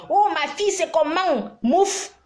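high, mock flirty call of the guys. Retelling how the guys hit on her, high mock call. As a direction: imitate the men chatting her up, pitched high. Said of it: "ces mêmes gars commencent à me chercher".

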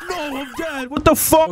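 very high, loud outburst on getting killed; shocked yell. Real, not acted. Player suddenly killed in the game, shocked yell. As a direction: scream it in outraged disbelief.

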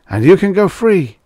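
high, loud tempting; commanding voice. A narrator's reading. Unseen voice tempts the man with freedom, high and commanding. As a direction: urge it on, pitched well up. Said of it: "said the voice".

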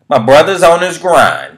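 high, loud and slow hype call; hyped shout. Acted. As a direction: belt it out like a hype man. Repeated catchphrase, hyped second take.

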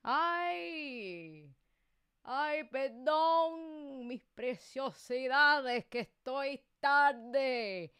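effusive apology. Real, not acted. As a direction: stretch out the apology to your audience, loud and slow. Apologizing to viewers for lateness. (disculpa efusiva y alargada)

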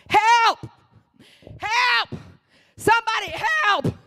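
high-pitched cry for rescue; very high, drawn-out yell; acted scream for help. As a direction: holler for help from a sinking boat. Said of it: "And I'm screaming".

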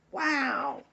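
ordinary delivery

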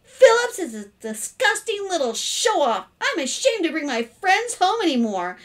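high, loud outburst; indignant rant. A narrator's reading. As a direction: pour out a sister's disgust. Indignant daughter rants about brother.

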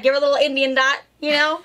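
usual speaking voice

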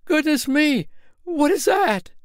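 high, alarmed squeak of fear; startled fright. Acted. Tinker clutching his friend's arm at a noise in the wood, scared. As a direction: jump at a noise in the dark. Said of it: "said the tinker, clutching his companion's arm".

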